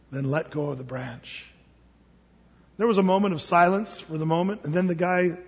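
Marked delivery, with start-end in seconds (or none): 0.1-1.5 low, very soft divine command; quiet and low, utterly calm; voice of God. Acted. God tells the climber to let go, calm heavenly voice. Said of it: "And the Lord says".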